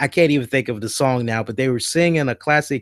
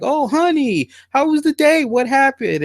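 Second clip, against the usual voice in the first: very high, sing-song falsetto; over-sweet fake concern, pitched way up; fussing dad; 12 semitones higher than usual. Dad greets his returning daughter in high sugary falsetto. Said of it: "And he's like".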